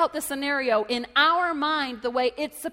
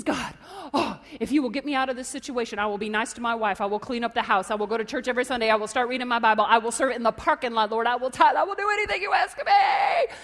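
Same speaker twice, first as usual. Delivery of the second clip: desperate bargaining; 4 dB louder than usual. Acted. Man on the cliff bargaining with God, mounting desperate plea. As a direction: gabble promises, then cry out at the end.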